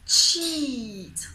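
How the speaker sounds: CHEAT loud, with a long, falling EE; long smiling EE. Shown as a demonstration. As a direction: smile through a stretched EE in CHEAT. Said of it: "Look at my lovely smile again".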